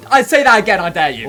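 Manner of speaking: loud mock dare; loud taunt. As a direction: bark the dare like a tough guy.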